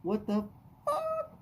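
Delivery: hammed-up high falsetto of an indignant wife; imitating his wife. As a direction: squeak out the wife's outrage at the prices. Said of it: "My wife was like".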